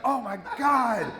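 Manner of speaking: high, slow swooning voice with a laugh; drawn-out starstruck sigh; imitating the drunk fan swooning, high and slow; swooning girl.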